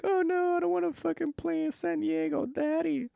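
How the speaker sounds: very high, whining mock voice; whiny Eli Manning. Mocking Eli Manning begging his father, high whine. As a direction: whine like a spoiled kid begging his daddy. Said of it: "crying little bitch Eli Manning".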